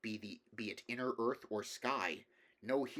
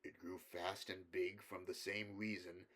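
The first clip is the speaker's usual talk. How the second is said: softer, weighing every word; careful grave scholar; 4 dB quieter than usual. Acted. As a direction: measure each word like a wary professor. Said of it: "chose his words very carefully".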